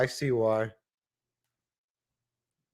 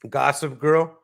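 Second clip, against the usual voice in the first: usual speaking voice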